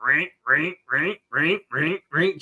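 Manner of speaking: squeaky crunching footsteps noise; imitated sand crunch. Imitating the footstep sound effect in beach sand. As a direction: make the crunch of running in sand. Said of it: "it's like".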